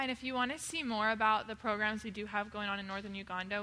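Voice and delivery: ordinary talk, nothing marked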